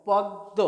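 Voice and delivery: "পদ্ম" split on a held double d, no m; doubled দ in পদ্ম. Shown as a demonstration. Teacher modelling the right form for learners, পদ্ম as "pod-do". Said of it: "তার উচ্চারণটা হবে".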